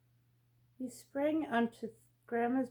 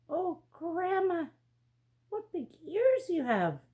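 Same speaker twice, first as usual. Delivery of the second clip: put-on curious girl looking closely; girl to grandma, a hint of wonder; little girl voice; 8 semitones higher, 4 dB louder than usual.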